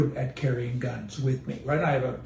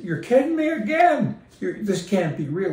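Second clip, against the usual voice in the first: incredulous; 6 semitones higher, 3 dB louder than usual. Retold or imitated. Retold disbelief at airport counter.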